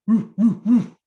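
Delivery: dog answers the call; dog barking. Acted. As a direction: bark like an eager dog. Dog barks.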